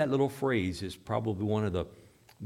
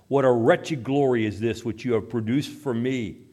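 raised voice, emphatic angry dismissal; loud cursing; 3 semitones higher, 7 dB louder than usual. Retold or imitated. Dying atheist cursing his unbelieving friends to their faces, loudly. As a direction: cry out loudly, driving them away. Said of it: "he loudly repeated".

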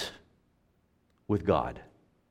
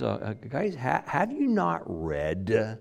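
a little sarcasm; 3 semitones lower, 9 dB quieter than usual. Retold or imitated. Jesus gently mocking the religious leaders, sarcastic. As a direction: poke at them just a little. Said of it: "There's just a little bit of sarcasm in his voice".

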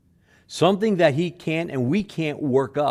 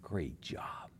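God's praise; 8 semitones lower, 16 dB quieter than usual. Retold or imitated. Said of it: "he looks at us and says".

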